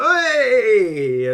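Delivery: loud, high celebratory "Hey!"; imitated crowd cheer. Crowd in the gif greeting the manager, loud high cheer. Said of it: "They're like".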